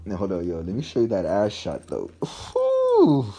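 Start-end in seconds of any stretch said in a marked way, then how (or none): 2.2-3.3 very high, loud whistling whew; impressed whew. Real, not acted. Vlogger showing the shoes, a high loud whew. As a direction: blow out in awe.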